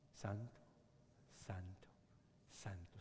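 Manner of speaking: hushed santos fading to a whisper; awed murmur, dropping to a whisper. Acted. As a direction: breathe the last santo. Reverent hushed cry after thunder.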